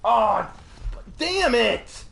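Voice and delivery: high, loud burst of annoyance; frustrated curse. Real, not acted. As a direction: curse loudly as the game beats you. Loses in the game.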